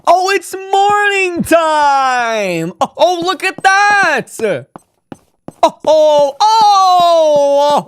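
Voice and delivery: excited cries. Acted. Delight at the morning scene.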